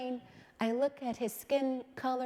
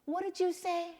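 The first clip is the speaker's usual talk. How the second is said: higher, startled what did you say; mock alarm at her son; shocked question; 8 semitones higher than usual. Mother retelling her shock at her son, surprised.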